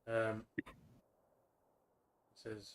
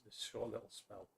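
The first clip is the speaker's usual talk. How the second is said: usual speaking voice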